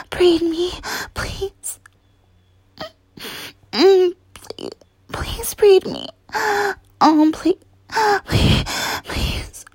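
high, whimpered pleas; desperate begging. Pretend. Lover begging a partner, desperate and breathless. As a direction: beg breathlessly, breaking into whisper. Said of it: "such a desperate fucking begging".

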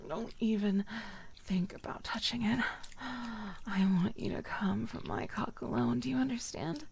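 ordinary delivery

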